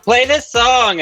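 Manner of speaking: put-on drunk fan. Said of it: "drunk hun coming up to you being like".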